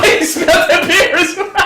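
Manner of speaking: high voice breaking into laughter; laughing. Real, not acted. Friend laughs as the other spills beer, voice high and laughing. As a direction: crack up as you point it out.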